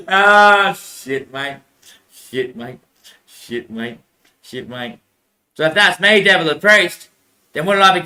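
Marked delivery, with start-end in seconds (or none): 0.7-5.0 muttered curse. Real, not acted.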